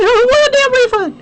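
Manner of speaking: very high, loud, fast, half laughing; laughing shout. Retold or imitated. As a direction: explode at the agent, laughing at the absurdity. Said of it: "I'm like".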